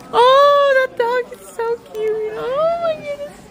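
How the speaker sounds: very high, melting squeal; gushing over a dog. Real, not acted. Greeting the dog that sniffed their leg, gushing. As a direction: squeal and coo, overcome by cuteness.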